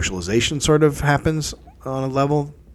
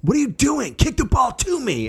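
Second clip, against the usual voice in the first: mock yell, pitched way up; kid yelling; 12 semitones higher than usual. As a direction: mimic a kid's indignant yell. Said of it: "the other kid starts yelling at him".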